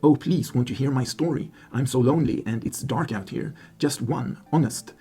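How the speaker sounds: ordinary talk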